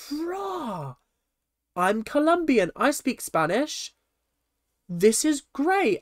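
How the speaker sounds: mock excited bride, pitched very high; high, over-thrilled gushing in imitation; excited squeal. Retelling the bride's reaction to hearing Spanish, mock excited.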